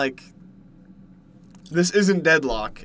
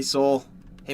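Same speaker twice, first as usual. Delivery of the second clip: ordinary talk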